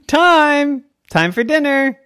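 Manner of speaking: high, loud dinner call. Acted. Parent calls the girl in for dinner, calling out. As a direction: holler it to a child outdoors.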